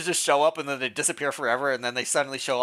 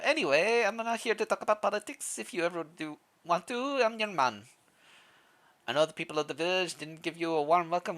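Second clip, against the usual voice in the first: put-on higher-pitched villager with a wandering accent; vague Russian accent; 4 semitones higher, 4 dB quieter than usual. Villager admitting he misjudged the newcomer, vague Russian accent. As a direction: loose Russian-ish accent, lifted in pitch. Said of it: "I don't know what accent I was doing".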